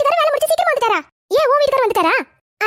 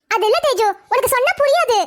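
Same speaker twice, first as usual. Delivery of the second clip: ordinary talk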